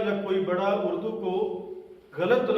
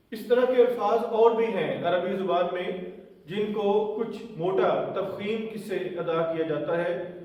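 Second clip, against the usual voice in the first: usual speaking voice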